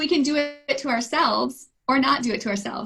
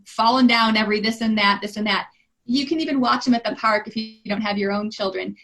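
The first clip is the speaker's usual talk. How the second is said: ordinary talk, nothing marked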